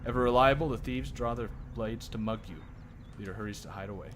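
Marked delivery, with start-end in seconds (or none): none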